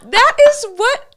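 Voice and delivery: high, loud protest through laughter; laughing protest. Real, not acted. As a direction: squeal it in amused disbelief. Mock outrage at friend's bridge remark.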